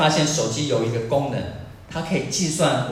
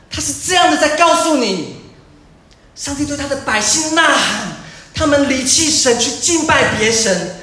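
high, loud, urgent pulpit roar; impassioned preacher's cry; preaching at full force; 12 semitones higher, 7 dB louder than usual. Acted. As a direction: thunder out God's claim to his people.

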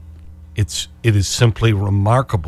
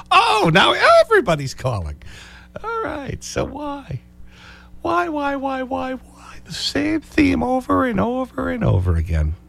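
much higher, exasperated "why, why"; mock excitement; 12 semitones higher than usual. Real, not acted. Host reacts to sudden calls, high-pitched and exasperated. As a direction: squeak it in exaggerated disbelief.

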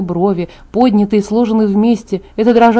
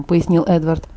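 usual speaking voice

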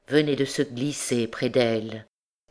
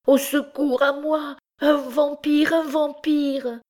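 very high-pitched cry of alarm; crying for help; 13 semitones higher, slower than usual. A narrator's reading. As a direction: call out for rescue in a high, panicked voice. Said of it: "se mit à crier".

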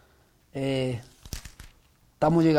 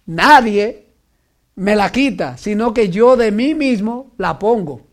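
louder proclaiming quote, rising in force; quoting Jesus; 3 semitones higher, 6 dB louder than usual. Retold or imitated. Preacher voices Jesus declaring no one takes his life, proclaiming. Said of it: "Jesús dijo".

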